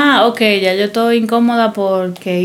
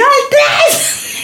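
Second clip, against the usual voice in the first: shouting. Retold or imitated. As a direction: lose your temper at last. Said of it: "Y yo".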